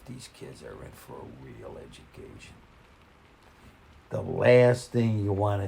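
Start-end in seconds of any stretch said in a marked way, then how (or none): 0.0-2.5 private thought. Retold or imitated. Private thought, told as an aside.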